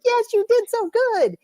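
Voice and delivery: high, gushing praise as if to a pup; cheering crowd. Retold or imitated. Crowd answering the eager little dog, gushing. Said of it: "And the crowd's like".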